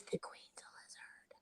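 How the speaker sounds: hushed conspiratorial whisper. Pretend. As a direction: whisper it like a secret.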